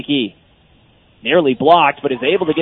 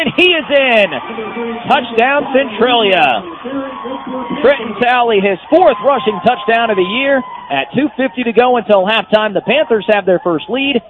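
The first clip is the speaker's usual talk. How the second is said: touchdown call; 5 semitones higher than usual. Real, not acted. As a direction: belt out the touchdown, then settle.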